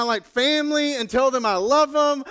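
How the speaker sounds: ordinary talk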